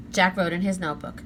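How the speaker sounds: ordinary delivery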